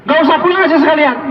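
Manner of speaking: high-pitched mock-angry dismissal; wife throwing husband out. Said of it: "Kalau istri ngusir sambil bilang".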